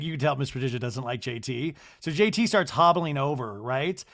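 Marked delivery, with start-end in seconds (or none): none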